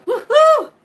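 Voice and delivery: loud, high whoop of triumph; joyful shouted woohoo; woohoo cheer. Real, not acted. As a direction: whoop like you just won. Celebrating YouTube partnership.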